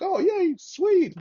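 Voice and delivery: very high-pitched retold cheer; mock excitement. Marine reacting to rumored Afghanistan move, high mock-excited cheer. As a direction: squeal it like playful delight.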